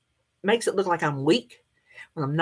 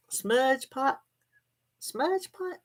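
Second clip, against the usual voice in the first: rising, softer, unsure "Smudge pot?"; doubtful questioning; 7 semitones higher, 5 dB quieter, much slower than usual. Real, not acted. Vlogger unsure of the word, asking viewers, rising softer.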